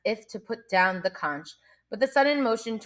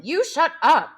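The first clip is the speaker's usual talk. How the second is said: angry retort; 9 semitones higher, 5 dB louder than usual. A narrator's reading.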